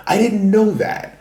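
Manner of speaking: high surprised squeak; shocked high voice. Real, not acted. Excited retelling of surprise.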